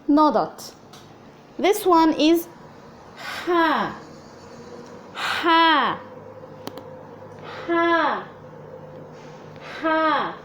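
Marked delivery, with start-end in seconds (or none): 1.6-8.3 ha as a breathy throat sound, higher; Arabic ḥa sound. Shown as a demonstration. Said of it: "not as in hat, not as in ha ha".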